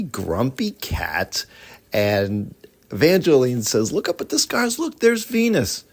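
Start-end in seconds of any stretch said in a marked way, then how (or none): 3.9-5.8 put-on higher, bright young girl reading; girl's voice. Evangeline pointing the stars out to the cat, eager girl voice.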